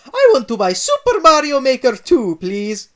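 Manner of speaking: high, loud Italian request; Mario buying his game, loud happy Italian. Acted.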